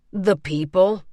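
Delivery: grave, choking down feeling; holding back emotion. A narrator's reading. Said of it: "struggling to keep his emotions in check".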